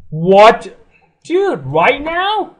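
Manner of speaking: loud, high, stretched-out disbelief; shocked shout. Real, not acted. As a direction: yell it, caught off guard. Shocked at sudden game event.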